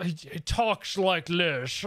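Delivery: slow, slurred-s actor impression; Sean Connery. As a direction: imitate the film star's way of talking. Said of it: "Great impression".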